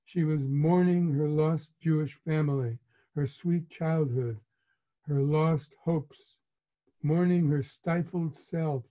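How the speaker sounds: usual speaking voice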